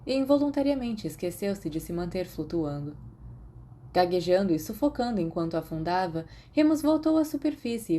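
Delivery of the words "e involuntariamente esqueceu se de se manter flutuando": ordinary delivery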